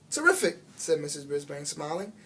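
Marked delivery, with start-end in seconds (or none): 0.1-0.6 bright, much higher praise; pleased. A narrator's reading. Teacher delighted with the shy girl, pitched high. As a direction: reward her with delight.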